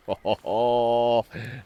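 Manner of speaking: drawn-out "oh-ho-ho" laugh; delighted chuckle. Real, not acted. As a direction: chuckle in real admiration. Pleased at the catch.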